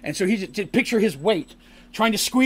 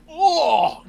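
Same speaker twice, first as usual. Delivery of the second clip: disgusted oh; 10 semitones higher than usual. Retold or imitated. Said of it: "just horrended by the smell".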